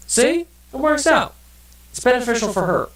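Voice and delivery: hammed-up squeaky justification; squeaky mock voice. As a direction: chirp it like a silly cartoon character. Justifying choosing the beagle.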